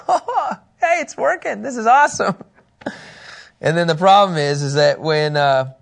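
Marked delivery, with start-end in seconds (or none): 0.1-2.4 much higher, giddy and delighted; excited Peter. Pretend. Peter walks on water toward Jesus, giddy and thrilled. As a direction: sell Peter's amazed glee.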